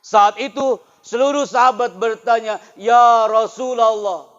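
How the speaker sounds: loud, high, impassioned sermon; loud, high storytelling sermon about the weeping palm; full-force preaching. Acted. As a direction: preach it with fire to the students. Preaching continues.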